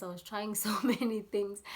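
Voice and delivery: usual speaking voice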